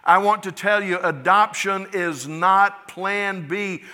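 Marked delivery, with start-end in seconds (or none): none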